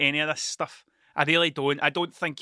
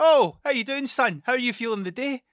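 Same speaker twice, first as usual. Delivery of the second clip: a much higher, louder chirpy greeting; cheery friend; 8 semitones higher, 4 dB louder than usual. Retold or imitated. Friend greeting him at the door, cheery high voice.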